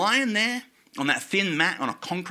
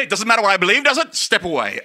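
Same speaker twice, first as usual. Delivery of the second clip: haughty reply; 7 semitones higher, 8 dB louder than usual. Retold or imitated.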